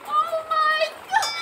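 high panicked cry. Real, not acted. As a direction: gasp it out in panic. Coaster plunge panic.

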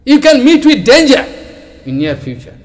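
high, loud angry rebuke; angry scolding. Retold or imitated. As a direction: snap at him in anger. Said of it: "He became very angry".

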